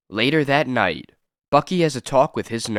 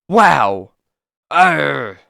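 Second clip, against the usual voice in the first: startled cry; 3 semitones higher, 6 dB louder than usual. Acted. Boy recoils from monster.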